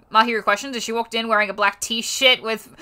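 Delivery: ordinary delivery, nothing marked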